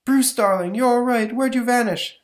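put-on high, worried girlfriend voice; breathless high-pitched sweetheart. Mona voiced in comic read.

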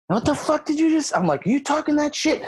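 high, furious mock yell; losing it. Lifter turning on his coach after a missed lift, high-pitched angry yell. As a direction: snap at him like he just insulted you. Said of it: "I start losing my mind".

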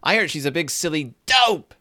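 high, quick tattling yell; hammed-up furious little sister; mock yelling. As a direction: yell it as a childish accusation. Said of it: "And she starts yelling".

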